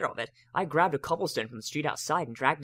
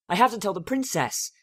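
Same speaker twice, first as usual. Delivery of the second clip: Spike urgent; 7 semitones higher, 5 dB louder than usual. A narrator's reading. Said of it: "says Spike".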